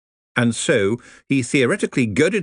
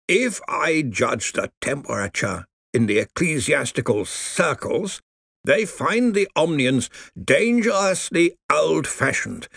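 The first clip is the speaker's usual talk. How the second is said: put-on dry crackling old lawyer voice; grave undead lawyer, slow; crackly zombie lawyer; 7 semitones higher, slower than usual. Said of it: "his voice crackled".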